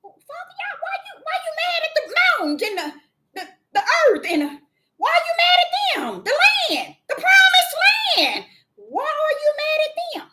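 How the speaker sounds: high, pleading bewilderment; puzzled questioning. Retold or imitated. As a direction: question the Father high and baffled, a few words shouted. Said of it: "I'm like".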